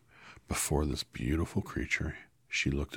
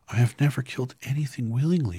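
much higher, louder tearful plea; girl sobbing to the wizard; 10 semitones higher, 9 dB louder, faster than usual. Acted. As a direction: cry the words out. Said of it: "she sobbed".

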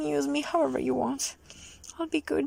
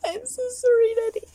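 tearful apology; 8 semitones higher, 9 dB louder than usual. Acted.

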